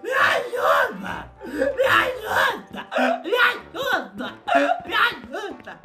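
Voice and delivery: slow, pleading cries with laughter breaking through; hammed-up begging. Caller pleading with the officers in hammed-up wailing. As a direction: wail for help like a bad actor. Begging for help in staged panic.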